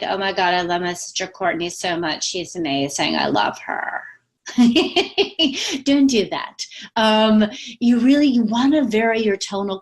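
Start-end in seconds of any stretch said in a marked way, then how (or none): 0.0-4.0 imitating Kardashian vocal fry, same range throughout; lightly mocking a reality star's fry. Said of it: "they just talk like this".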